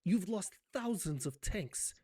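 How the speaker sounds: ordinary delivery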